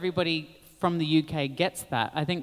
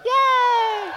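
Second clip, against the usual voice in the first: cheer; 6 dB louder than usual. Real, not acted. Cheering a first place.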